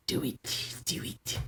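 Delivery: very soft, voiceless whisper; hushed, insidious urging whisper; sinister sword whisper. Acted. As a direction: whisper temptation into someone's ear. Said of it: "like, whispering to him".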